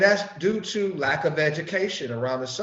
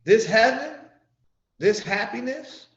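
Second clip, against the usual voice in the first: higher, louder, pleading preaching; earnest preaching; 5 semitones higher, 3 dB louder than usual. Retold or imitated. Preacher addressing his brother in the eye, raised and earnest. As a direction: call it out to your brother. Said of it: "look him in the eye and say".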